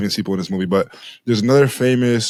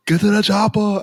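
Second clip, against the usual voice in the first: pitched-up mock Austrian accent; Arnold accent; 11 semitones higher than usual. Quoting a favorite Predator line to his cohost, in a mock Arnold accent. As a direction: bark it like Arnold in Predator, much higher. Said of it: "but I'm just using Arnold".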